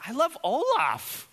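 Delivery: put-on very high, loud little-girl voice; toddler voice. Pastor voicing his daughter declaring love for Olaf, high and loud. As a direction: squeal it like a delighted two-year-old.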